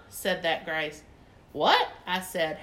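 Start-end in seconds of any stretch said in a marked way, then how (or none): 1.5-1.9 loud, high rising what; puzzled what. Acted. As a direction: blurt it out, baffled. Narrator puzzled by the rule.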